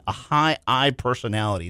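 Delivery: ordinary talk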